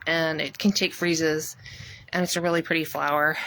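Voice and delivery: usual speaking voice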